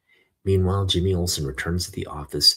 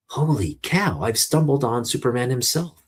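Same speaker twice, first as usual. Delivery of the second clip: high, excited gasp of discovery; astonished exclamation; 6 semitones higher than usual. Acted. Jimmy in his diving suit, startled at finding Superman, exclaims high. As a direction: blurt it out, amazed.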